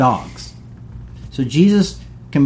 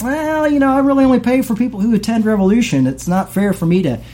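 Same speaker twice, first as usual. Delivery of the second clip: high-pitched mock excuse, quickened midway; mock evasive refusal; 9 semitones higher than usual. Pastor turning down someone's prayer request, mock evasive. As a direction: put on a high voice to beg off.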